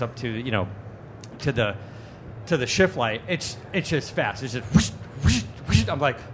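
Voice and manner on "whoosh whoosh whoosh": quick airy whooshes; imitated whooshing. Driver showing how fast shift lights climb, imitated whooshes. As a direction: make rushing whoosh noises.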